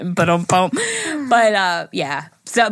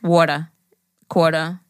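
Long Island accent; 5 semitones lower than usual. Shown as a demonstration. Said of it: "made fun of my waters and quarters".

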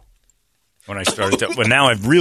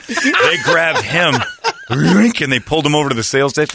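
laughing; 5 semitones higher, 5 dB louder than usual. Real, not acted. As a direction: laugh as you tell it. Laughing while telling.

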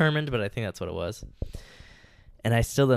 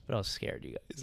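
soft unvoiced confession; hushed whisper; 2 semitones lower, 10 dB quieter than usual. Real, not acted. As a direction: drop to a whisper. Admits fear, drops to whisper.

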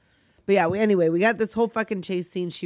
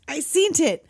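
mock accusation; 7 semitones higher, 4 dB louder than usual. Acted quote of the fisherman.

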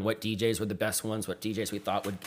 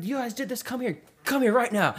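much higher mock British gushing; a light British-accent impression of a thrilled fan; hint of a British accent, pitched up with delight; 13 semitones higher than usual. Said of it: "He's talking in his British accent".

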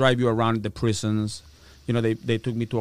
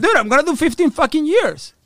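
laughing outrage; 13 semitones higher, 5 dB louder than usual. Retold or imitated.

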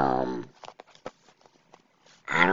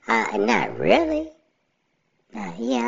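funny voice. Pretend. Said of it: "So I'ma use a robotic and funny voice".

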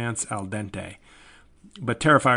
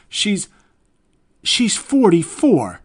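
higher, louder, laughing disbelief; incredulous; 8 semitones higher, 10 dB louder, slower than usual. Real, not acted. Vlogger ranting to viewers about the actress playing a teenager, incredulous. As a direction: hammer home how absurd it is. Repeating shocking age.

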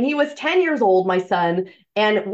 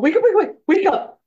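higher, louder, urgent mock wake-up; excited wake-up call; 9 semitones higher, 3 dB louder than usual. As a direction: shake the son awake with big news. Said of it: "I'm like".

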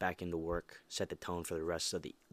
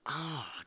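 whispered sigh of delight; 7 semitones higher than usual. Real, not acted. Relishing the idea.

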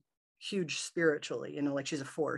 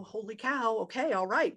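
startled high-pitched reaction, retold; reliving the moment of shock; surprised; 6 semitones higher than usual. Retold shock at hand passing through.

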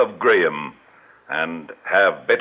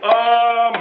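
effusive greeting; 13 semitones higher, 4 dB louder than usual. Acted. Con man greets mark effusively.

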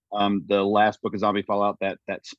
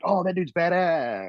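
higher, drawn-out mock admiration; imitated awe; 8 semitones higher, slower than usual. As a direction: voice an impressed fan, slow and high. Voices admiring readers' reaction.

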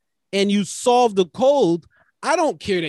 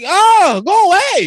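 higher and louder, mock shooing someone off; dismissive brush-off; 11 semitones higher, 6 dB louder than usual. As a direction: wave them away with a raised, irritated voice. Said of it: "It's like".

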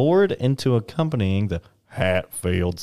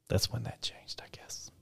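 soft conspiratorial whisper; dark whisper; 17 dB quieter than usual. Pretend. Adding a grim aside to the story, whispering.

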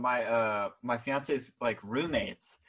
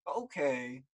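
dismissive; 5 dB quieter, much slower than usual. Retold or imitated. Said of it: "I love that you said the head, head tilt".

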